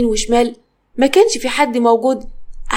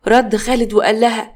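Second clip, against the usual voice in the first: ordinary delivery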